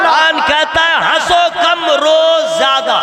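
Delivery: loud, slow sermon build; fervent preaching. Acted. Preacher calling out to the Quran, loud and fervent. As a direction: hammer the point home to the crowd.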